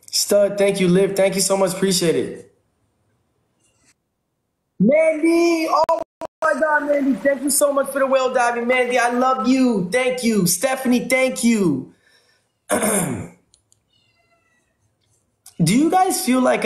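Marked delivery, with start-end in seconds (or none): 4.8-11.4 high, thrilled shout of thanks; excited thanks. Real, not acted. Streamer thrilled by Mandy's gift, excited high thanks. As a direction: gush over the gift at the top of the voice.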